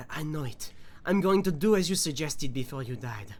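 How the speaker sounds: reading a note in a light French accent; a lightly done French accent reading aloud. Shown as a demonstration. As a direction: read the letter like a Frenchman, th turned to z. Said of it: "let me read this in my French voice".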